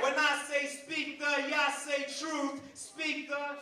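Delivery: rallying crowd chant lead-in; call-and-response. Acted. Poet warming up the crowd, rallying call-and-response.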